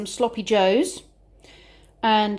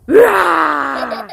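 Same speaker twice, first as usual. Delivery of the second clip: loud mock dinosaur roar; dinosaur rah; 4 semitones higher, 8 dB louder than usual. Mum roars like a dinosaur for the babies. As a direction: roar playfully like a toy dinosaur. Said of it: "Dinosaur says".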